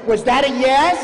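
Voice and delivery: loud wife demanding an answer; wife's sharp retort. Retold or imitated. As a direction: snap the question at him, loud and high. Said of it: "Tracy said".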